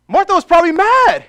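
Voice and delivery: preaching peak. Real, not acted.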